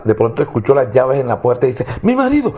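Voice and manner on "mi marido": high alarmed cry of "marido"; first "Mi marido" shot up high in panic; panicked wife. Acted. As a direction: blurt out "Mi marido" in sudden fright. Wife hears husband's keys.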